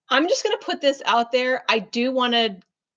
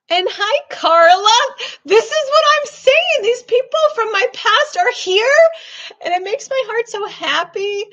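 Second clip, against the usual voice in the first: excited; 11 semitones higher, 7 dB louder than usual. Real, not acted. Greeting people from her past.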